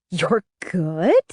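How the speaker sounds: appalled echo with a sharp rise at the end. A narrator's reading. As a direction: throw it back as a disbelieving question. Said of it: "She sounded appalled".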